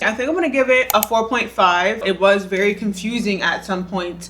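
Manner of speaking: ordinary talk, nothing marked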